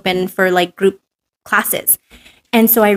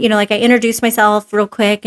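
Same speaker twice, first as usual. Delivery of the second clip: ordinary talk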